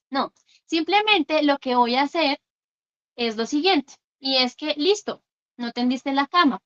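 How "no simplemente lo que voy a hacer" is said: normal talking voice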